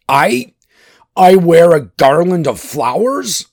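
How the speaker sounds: loud, high indignant cry; outraged disbelief. A narrator's reading. Thor hearing he must wear flowers, outraged. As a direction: splutter it as an affronted warrior.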